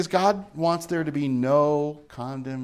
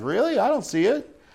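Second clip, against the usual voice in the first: mock surprised, much higher; lightly acted disbelief; a touch of incredulous doubt; doubtful; 7 semitones higher than usual. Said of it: "I'm like".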